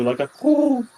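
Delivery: high, loud, drawn-out coo; imitated cooing call; bird coo.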